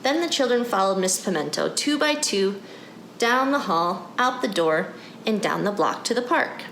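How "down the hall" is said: plain reading voice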